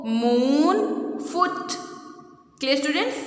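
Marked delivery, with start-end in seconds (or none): 0.1-0.8 मून with the oo held long and rising; long vowel word. Shown as a demonstration. Teacher giving the long sound example, drawn-out मून. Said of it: "long sound". 1.3-1.7 फुट with a quick, higher oo; short vowel word. Shown as a demonstration. Teacher giving the short sound example, brief फुट. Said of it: "short sound".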